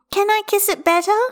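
put-on high, piping child's offer; little girl's voice. A narrator's reading. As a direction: ask it like a small child. Child offers comfort to father.

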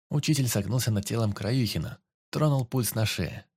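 ordinary delivery

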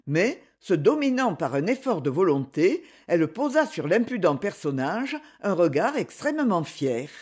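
ordinary delivery, nothing marked

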